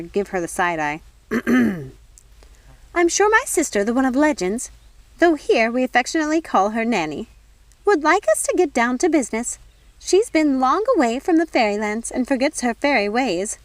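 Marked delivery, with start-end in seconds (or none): 1.3-1.9 a pointed, deliberate throat clear. Acted. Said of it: "Nanny cleared her throat". 3.0-13.6 higher, louder, prim fairy godmother; a fussy, superior fairy godmother smoothing things over. Acted. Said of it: "said the fairy godmother".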